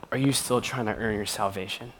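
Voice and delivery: usual speaking voice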